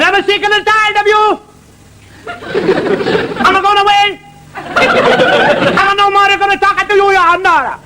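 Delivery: high, hammed-up Italian accent. Venetian husband leaving his wife, high hammy Italian accent. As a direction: play the fed-up Venetian husband, comic Italian. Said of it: "the fellow in Venice".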